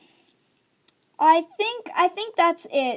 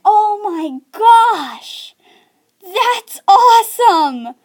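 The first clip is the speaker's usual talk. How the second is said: high, loud and stretched-out; amazed realization; 5 semitones higher, 7 dB louder, much slower than usual. Acted. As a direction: gush slowly as the light bulb goes off. Acts sudden realization, light bulb moment.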